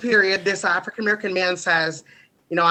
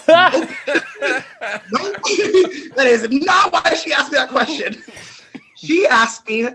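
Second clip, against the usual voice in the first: loud, laughing burst, high and bright; laughing outburst; 8 semitones higher, 7 dB louder than usual. Real, not acted. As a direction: blurt it out while cracking up. Laughing at his own story.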